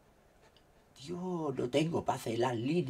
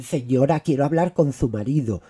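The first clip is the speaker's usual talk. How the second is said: louder mock phone caller; mimicking caller; 15 dB louder than usual. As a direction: imitate the pushy telemarketer. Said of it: "me dicen".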